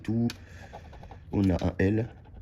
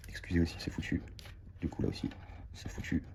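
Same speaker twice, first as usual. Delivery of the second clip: muttered to self; 10 dB quieter than usual. Real, not acted. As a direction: mutter it under your breath, resigned. Cards keep losing.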